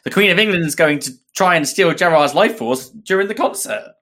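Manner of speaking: lightly voiced Matt; a hint of Matt's voice. Redoing Matt's line in his voice, lightly. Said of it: "Matt voice again".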